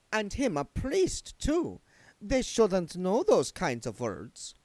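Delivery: high, slow, affected countess voice; a lofty vampire countess, drawn out; Doreen's voice. A narrator's reading. Doreen's character voice.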